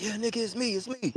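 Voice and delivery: high, soft acted-out plea; mock-urgent hushed insistence; urgent pleading. Hiding, reassures startled friend.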